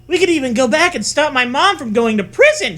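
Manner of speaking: high, fast excited shriek. A narrator's reading. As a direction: shriek it out, breathless. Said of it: "she shrieks".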